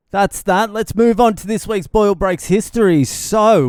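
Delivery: bursting into the history segment; show segment announcer. Acted. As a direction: sell the new segment like a radio host.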